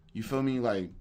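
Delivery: ordinary talk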